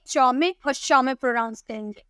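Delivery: ordinary talk, nothing marked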